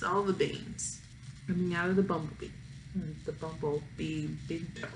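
trailing off into a whisper; fading whisper. A narrator's reading. Narrator hushing the bean spill, fading to a whisper. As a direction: let it fade to a breathy hush.

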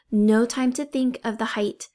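ordinary delivery, nothing marked

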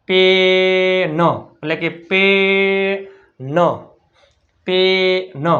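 stretched, teacherly પેન; drawn-out pronunciation demo. Shown as a demonstration. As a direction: sound out પેન very slowly with a long એ. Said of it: "એન ઉચ્ચાર શું થાય?".

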